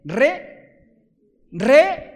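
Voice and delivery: "re" punched high and loud; stress on re. Shown as a demonstration. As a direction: hit "Re, re" hard and high, each on its own. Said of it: "no dijo mentirosito".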